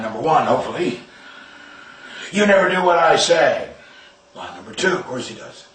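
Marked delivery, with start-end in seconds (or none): 2.3-3.7 higher, louder scolding yell; angry yell. Retold or imitated. Angry parent yelling at the son after the crash. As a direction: lash out at the kid.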